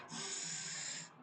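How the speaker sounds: thin sustained hiss; whistle-like s. Shown as a demonstration. Teacher repeating the seen sound, whistle-like hiss. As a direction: let the s hiss on without voice. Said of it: "like a whistle sound".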